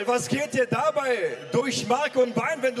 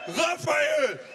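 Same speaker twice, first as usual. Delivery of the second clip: boxing ring announcer; 3 semitones higher than usual. Real, not acted. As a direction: announce the fighter to the crowd. Fighter introduction in the ring.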